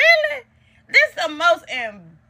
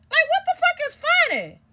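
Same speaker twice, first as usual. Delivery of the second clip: indignant. Real, not acted. Angry at smiling mama.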